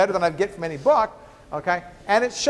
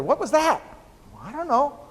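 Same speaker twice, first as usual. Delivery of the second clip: startled dean; 8 semitones higher than usual. Acted. Startled deans in the joke.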